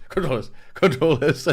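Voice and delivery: the joke cracks him up mid-imitation; mimicking the save reflex, laughing. Mocking a friend's compulsive saving, laughing loudly.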